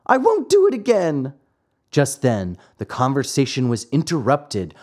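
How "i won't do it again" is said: high-voiced tearful puppet boy; acted tearful promise. Puppet promises the glowworm, high and mildly tearful. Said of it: "cried Pinocchio in tears".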